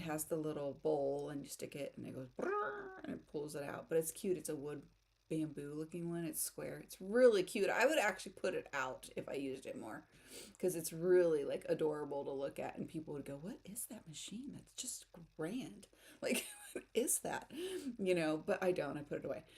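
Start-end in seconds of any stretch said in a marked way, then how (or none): 2.4-3.0 machine noise. Retold or imitated. Imitating mill noise. 13.4-17.5 whispered awe at the machine. Retold or imitated. Imitating people seeing machine.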